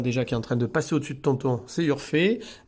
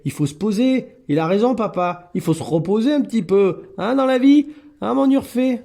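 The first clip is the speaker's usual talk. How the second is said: high, louder sing-song talk to a cat; high pet talk; 8 semitones higher, 7 dB louder than usual. Real, not acted. Teasing cat Urfé about partying, high and loud. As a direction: chide the cat fondly, up high.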